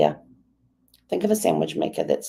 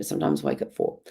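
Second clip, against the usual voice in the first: ordinary talk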